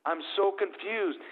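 high-pitched imitation of a confused young boy; David. Ten-year-old son to his family on baby news, imitated high confusion. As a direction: voice a puzzled child, pitched well up. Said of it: "My ten-year-old, uh, David".

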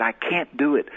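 ordinary delivery

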